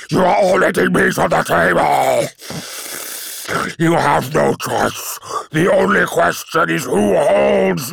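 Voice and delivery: high, loud raging demon shouts; chained demon. Acted. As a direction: bellow like a trapped beast. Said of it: "roared the demon".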